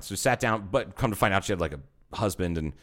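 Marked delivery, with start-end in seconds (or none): none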